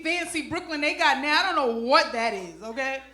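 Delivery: ordinary talk, nothing marked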